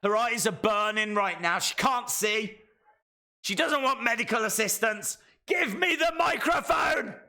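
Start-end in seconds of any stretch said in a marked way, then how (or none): 5.5-7.1 high, shouted mock demand for the mic; mock yell. Reacting to a wrestling promo, demanding the microphone in a high mock yell.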